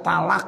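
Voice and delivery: "talak" with light t and open a; ta-initial pronunciation. Shown as a demonstration. Teacher repeating the lay form, "talak" with ta. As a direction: say "talak", ta instead of to. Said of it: "pakai tak".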